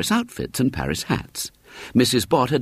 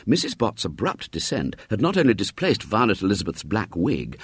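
plain reading voice

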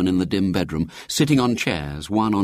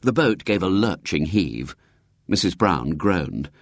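usual speaking voice

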